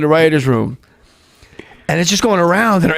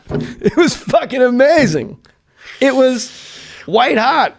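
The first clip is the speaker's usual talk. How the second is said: higher, slower, laughing praise; excited, laughing; 8 semitones higher, slower than usual. Real, not acted. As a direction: gush about it through a laugh, pitched up.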